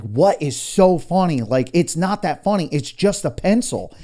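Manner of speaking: mock outrage over something tiny; exasperated. Asking laughing family what is funny, exasperated. Retold protest at being laughed at.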